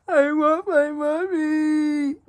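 put-on high slow whimpering child; scared little kid voice. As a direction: whine it like a scared four-year-old. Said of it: "and he says, says that it's just like".

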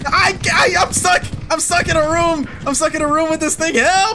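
high, urgent pleading for rescue; panicked calling. Real, not acted. Player stuck in a room with a creature, panicked. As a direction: shout for rescue with pitch pushed way up.

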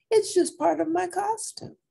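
high-pitched little cat voice; gatito's answer. A narrator's reading. Gatito explaining the costume, high kitten voice. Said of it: "answered the gatito".

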